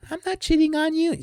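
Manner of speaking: high falsetto mocking denial; very high mocking girlfriend voice. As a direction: squeak it like a lying girlfriend.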